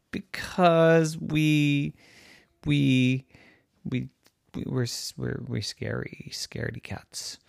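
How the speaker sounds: stuttering, trailing to a whisper; stammer, fading out scared; nervous stammer. Acted. Dinosaurs stammer in fear.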